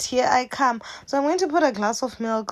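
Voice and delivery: ordinary talk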